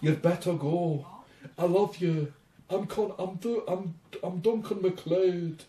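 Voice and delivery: slow, mock-solemn bad Scottish accent; lightly done Scottish accent mocking the actor; bad Duncan MacLeod. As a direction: ham up a poor Scottish accent. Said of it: "my worst Scottish accent attempt".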